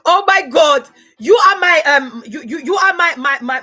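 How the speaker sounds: loud, high squeals of delight with laughter; excited shriek. Real, not acted. Shrieking with delight at a viewer's milkshake comment. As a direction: shriek with real joy at the comment.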